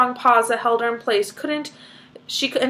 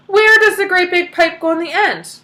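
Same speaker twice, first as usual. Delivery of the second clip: high, loud frantic question; shrieking question; 8 semitones higher, 7 dB louder than usual. Acted. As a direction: shriek it at Wonka. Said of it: "shrieked Missus Salt".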